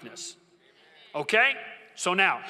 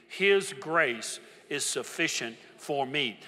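slow call and response; 3 semitones lower, slower than usual. Shown as a demonstration. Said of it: "Say it with me".

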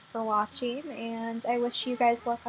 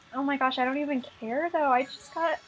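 ordinary talk, nothing marked

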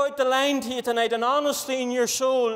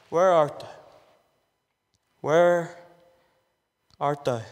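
low, drawn-out call of God, word by word; 8 semitones lower than usual. Acted. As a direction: call out slowly like God searching. God's voice, repeated refrain.

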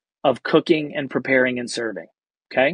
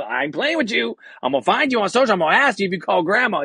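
excited promise; 6 semitones higher, faster than usual. Retold or imitated. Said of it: "He's like".

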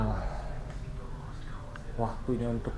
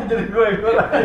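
high, fast, laughing echo; 10 semitones higher, 8 dB louder, much faster than usual. Acted. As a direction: laugh it back while repeating. Echoes partner, laughing.

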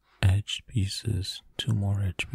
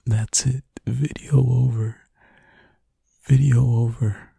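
mock exasperation; 3 semitones higher, 9 dB louder than usual. Mock quitting over lost puzzle.

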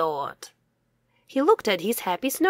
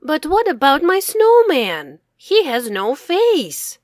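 high, louder plea; crying out; 6 semitones higher, 5 dB louder than usual. Acted. Boy upset that his snowman has no face, crying out. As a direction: call out upset, pleading. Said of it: "cried Max".

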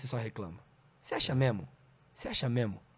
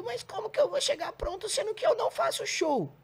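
very high squeaky mock voice; mock whiny objection. Mimicking a comic who has no shows, in a squeaky whine. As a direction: squeal it like a helpless complainer.

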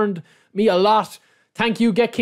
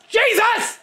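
high, sudden exasperated cry. Real, not acted. Streamer misses the king again, crying out high in frustration. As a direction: burst out in frustration. Another miss on the spin.

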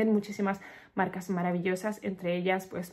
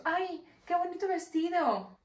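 much higher, slow, cooing imitation; sweet compliment; 9 semitones higher, slower than usual. Security man complimenting her dress, imitated high sweet coo. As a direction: imitate someone gushing over a dress, high and drawn out. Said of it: "muy mono, me ha dicho".

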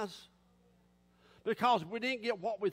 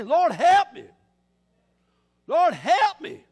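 loud, high cry to God; acted cry for help, punched hard on help; desperate plea; 5 semitones higher, 11 dB louder, slower than usual. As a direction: belt it out as a plea. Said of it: "He's just out of desperation".

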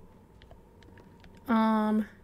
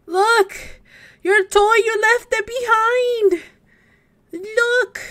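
calling out; 8 semitones higher, 8 dB louder, slower than usual. Real, not acted. Player calling the child back to his toy, loud and high. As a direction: shout after him to come back, up high.

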